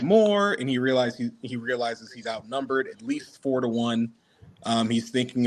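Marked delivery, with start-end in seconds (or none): none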